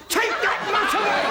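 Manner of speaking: high, drawn-out yell; exasperated shout. Acted. Husband ordering the scratching dog away, exasperated shout.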